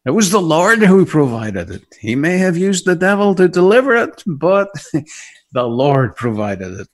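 loud, certain reply; firm old woman. Retold or imitated. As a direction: insist it was the Lord, loudly. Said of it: "she said".

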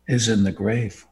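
ordinary talk, nothing marked

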